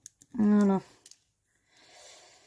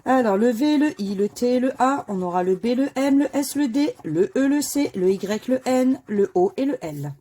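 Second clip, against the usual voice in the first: raised, loud call of each letter; higher, louder bingo-caller style; letter draw announcement; 4 semitones higher, 8 dB louder than usual. Acted. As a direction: announce every letter like a game-show draw. Calls out the drawn letters.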